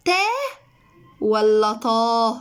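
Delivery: تا light, then طا heavy and slow; contrast ta and tah. Shown as a demonstration. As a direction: set تا against a slow emphatic طا. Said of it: "شوفوا الفرق عامل ازاي". (مقارنة بين تا وطا ببطء)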